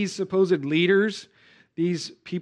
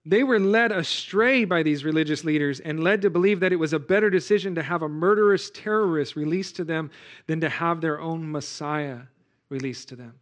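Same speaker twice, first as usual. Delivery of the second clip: ordinary delivery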